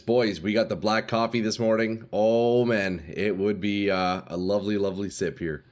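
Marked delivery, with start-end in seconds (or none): none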